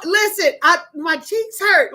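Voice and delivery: usual speaking voice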